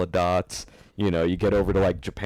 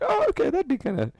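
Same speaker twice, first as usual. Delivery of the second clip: high mock surprise. Mock reaction to Chinese listeners.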